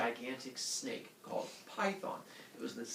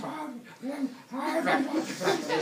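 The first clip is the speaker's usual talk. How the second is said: high, loud mock wailing noise; imitated runner's wail; 12 semitones higher, 6 dB louder than usual. Pregnant woman keeps running, high mock wail. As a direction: wail high, as if lumbering along.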